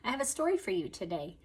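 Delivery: ordinary delivery, nothing marked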